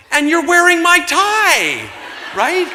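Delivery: long, high, loud holler; comic yell. Retold or imitated. Yelling at the man as he runs past, drawn-out high shout. Yells it while running past.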